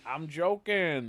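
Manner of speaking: loud, long drawn-out "joking" catchphrase; big hammed-up backpedal; imitating sketch professor. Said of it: "He goes".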